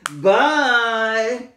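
high, loud goofy voice; a playful silly voice for a speech device. Shown as a demonstration. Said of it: "that's my silly voice".

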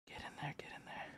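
very soft, quick whisper; barely audible urging; hushed coaxing. Real, not acted. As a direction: whisper it to a card you dare not damage. Coaxing the card into a sleeve.

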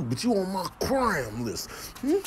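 normal talking voice

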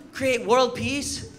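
usual speaking voice

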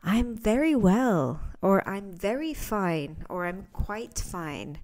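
slow, careful textbook English; overly formal, slow learner reply; stiff learner phrasing. Retold or imitated. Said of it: "people whose English is not their native language saying".

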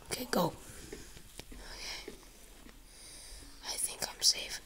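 relieved hushed whisper; soft whispered relief. Real, not acted. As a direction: breathe it out quietly. Sneaking away from monster.

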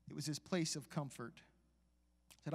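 ordinary talk

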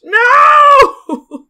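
shrieking no in real panic; panicked shout. Real, not acted. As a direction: yell out in alarm. Panic at being caught.

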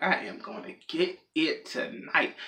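slow, hushed gloating aside; smug date. Acted. Date privately anticipating the night, slow and hushed. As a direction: savour it softly, word by word. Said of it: "And they probably like".

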